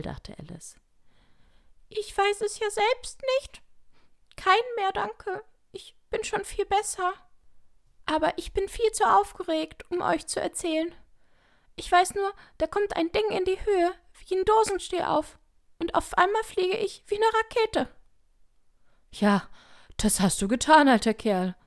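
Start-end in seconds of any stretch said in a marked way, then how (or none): 1.9-17.9 very high, squeaky little creature; small squeaking voice. A narrator's reading. Small lizard tells the others of being launched, squeaking feebly. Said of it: "eine kleine, schwache, quiekende Stimme". 19.1-21.5 others replying. A narrator's reading. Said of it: "sagten die anderen".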